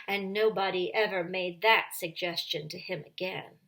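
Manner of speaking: ordinary delivery, nothing marked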